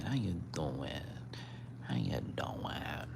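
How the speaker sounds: soft unvoiced whisper to the cat. Real, not acted. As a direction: breathe it out without voice. Repeated greeting fading to whisper.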